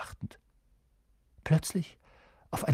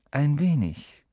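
musing echo with a falling sigh; 4 semitones higher, 3 dB louder, slower than usual. A narrator's reading. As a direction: echo it back pensively, letting it fall. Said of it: "seufzte Ronan". (nachdenklich seufzend wiederholt)

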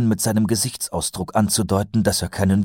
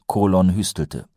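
ordinary delivery, nothing marked

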